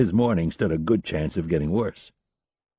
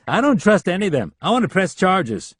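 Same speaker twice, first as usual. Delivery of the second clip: indignant; 11 semitones higher, 4 dB louder than usual. A narrator's reading. Leland refuses, demands charges.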